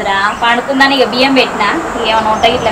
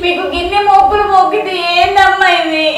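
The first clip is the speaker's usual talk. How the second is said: anguished prayer; 5 semitones higher than usual. Acted. Mother-in-law cries out to gods.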